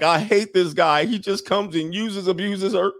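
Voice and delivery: mock female lament; whiny woman complaining. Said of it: "And then she's like".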